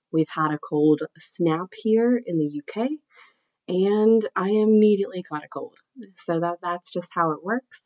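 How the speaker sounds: ordinary talk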